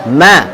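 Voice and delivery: "Ma" with a light open a; correct thin ma. Shown as a demonstration. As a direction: keep "Ma" thin, not rounded.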